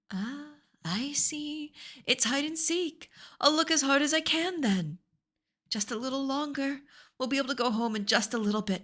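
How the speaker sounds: sing-song hunt for a hidden friend; cheerful Hitori. Acted.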